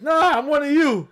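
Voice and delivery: high, loud pleading holler; mock protest shout. Joker pleads with Italian police not to deport them, mock protest shout. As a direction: protest loudly to imaginary police.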